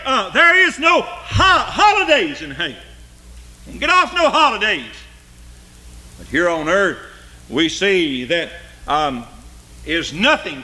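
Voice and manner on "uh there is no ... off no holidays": soaring, loud hellfire preaching; preaching at full force. Acted. Preacher warning his congregation of hell's torment, full force. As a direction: preach the torment, voice climbing high.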